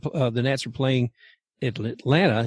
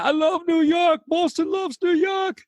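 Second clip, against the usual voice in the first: high-pitched mock shout of a crazed fan; imitated shouting; slower than usual. Host retelling the intruder in Fenway, high mock shouting. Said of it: "he started shouting".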